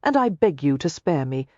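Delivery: ordinary delivery, nothing marked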